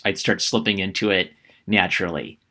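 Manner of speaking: ordinary delivery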